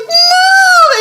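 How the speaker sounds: very high, loud mock wail; bawling wail. Mom imitating child who found the torso, bawling. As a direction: cry out in overblown grief.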